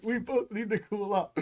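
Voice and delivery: high-pitched mock plea to calm down; hammed-up, high agitated voice; mock agitation. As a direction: imitate someone heated calling for calm. Mocking the texted argument.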